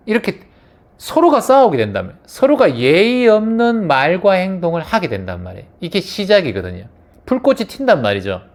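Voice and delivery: ordinary talk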